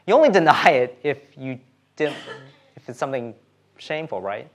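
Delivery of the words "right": ordinary delivery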